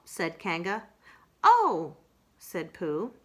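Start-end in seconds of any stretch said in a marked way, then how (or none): 1.4-2.0 higher, louder small oh; a deflated little oh. Acted. Pooh deflated by Kanga's answer, higher.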